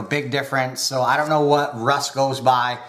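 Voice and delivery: normal talking voice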